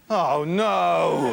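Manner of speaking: loud, high, drawn-out wail; comic despairing outburst; dismayed cry. Acted. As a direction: cry out in horror at yourself. Host catches himself doing uptalk.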